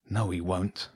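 soft, muttered refusal; muttering. A narrator's reading. James refusing to tell Hugo upstairs, muttering. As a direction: mutter it under your breath. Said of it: "muttered James".

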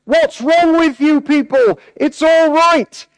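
high, slow, strained call to the crowd; mock a dictator yelling down from a balcony; imitated shout. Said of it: "And he's shouting out".

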